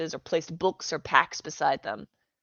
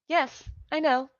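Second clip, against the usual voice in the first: put-on cheerful girl; 9 semitones higher than usual.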